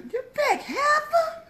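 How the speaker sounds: shrill laughing heifer insult; high mocking voice. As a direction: squeal it out mid-laugh. Quoting movie line.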